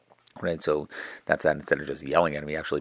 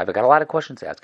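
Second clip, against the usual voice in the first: higher, louder mock insistence; pushy provoker; 7 semitones higher, 8 dB louder than usual. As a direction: push him with a loud challenge. Provoker pushing Hillel.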